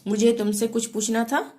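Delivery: ordinary delivery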